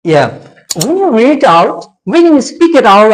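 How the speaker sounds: ordinary talk, nothing marked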